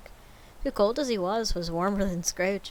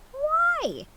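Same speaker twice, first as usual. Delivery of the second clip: high mocking echo; 4 dB louder than usual. Scrooge echoes nephew.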